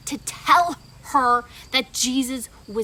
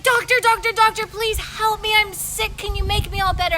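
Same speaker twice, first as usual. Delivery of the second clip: pleading patient; 6 semitones higher, 4 dB louder than usual. Acted. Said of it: "and she's like".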